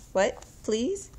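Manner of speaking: ordinary talk, nothing marked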